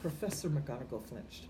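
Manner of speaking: usual speaking voice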